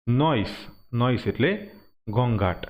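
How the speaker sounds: usual speaking voice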